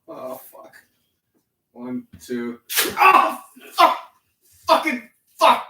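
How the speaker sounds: high pained cursing, building to shouts; swearing tirade. Real, not acted. Counting down to the rip.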